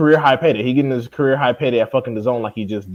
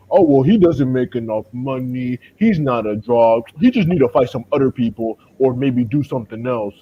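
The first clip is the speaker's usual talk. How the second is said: mock stupid talk. Mimicking people defending a dodged fight, mock stupid talk. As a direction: drag out the mock excuse like a fool. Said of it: "gonna say some stupid shit like".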